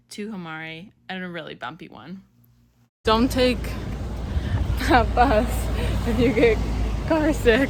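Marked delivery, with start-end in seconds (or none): 3.0-7.7 calling out over the noise; loud. Real, not acted. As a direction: shout the warning. Shouting advice over bumpy bus.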